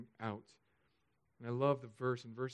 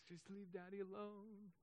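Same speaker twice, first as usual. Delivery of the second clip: soft, higher hushed aside; hushed wife; 5 semitones higher, 15 dB quieter than usual. Retold or imitated. Wife to kids about crying Daddy, hushed and high. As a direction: imitate a wife quietly shooing the kids. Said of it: "my wife's like".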